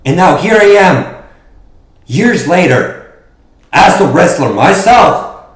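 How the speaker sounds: loud, fervent promo shout; wrestling promo. Acted. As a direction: hype up the crowd.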